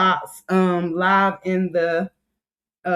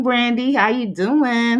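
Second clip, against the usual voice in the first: ordinary talk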